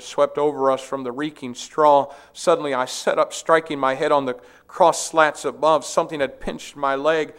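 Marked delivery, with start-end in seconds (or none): none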